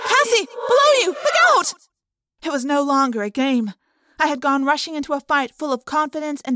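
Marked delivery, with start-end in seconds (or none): 0.1-1.7 very high, loud, frantic shouts; shouted warning. Acted. Warning a friend of a shark below, frantic shout. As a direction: yell a warning that climbs with each cry. Urgent warning during the fight.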